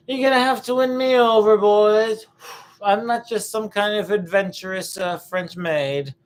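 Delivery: high, hammed-up coy voice; mock coquette. Mock coy answer to a suitor.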